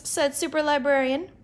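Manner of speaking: ordinary delivery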